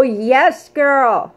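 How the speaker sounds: ordinary talk, nothing marked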